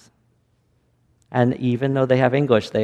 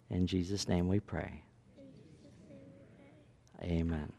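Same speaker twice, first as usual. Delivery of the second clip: prayer; 3 semitones lower, 6 dB quieter than usual. Real, not acted. Leads children in prayer.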